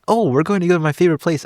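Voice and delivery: light up at the news; delighted wife. Retold or imitated. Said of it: "Okay, so we're gonna go".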